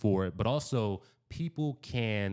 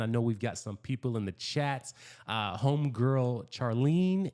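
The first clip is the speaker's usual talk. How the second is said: normal talking voice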